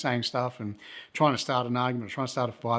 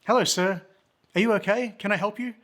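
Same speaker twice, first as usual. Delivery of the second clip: higher-pitched imitation of a polite local; helpful local man; 10 semitones higher, 4 dB louder than usual. One of the drinkers greets the lost visitor, high helpful voice. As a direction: play the obliging stranger, pitched well up.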